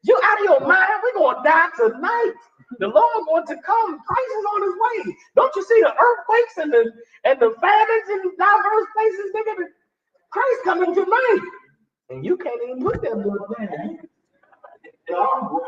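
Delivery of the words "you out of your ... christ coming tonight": high, frantic doomsday ranting; hammed-up apocalyptic panic; loud panicked voice. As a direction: scream warnings of the end times. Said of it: "that one that's loud, that's saying".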